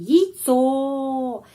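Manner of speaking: яйцо as yi-TSO with a long stressed O; yi sound. Shown as a demonstration. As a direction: open яйцо with yi and stretch the O. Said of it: "tapi yi". (яйцо dengan yi dan O panjang)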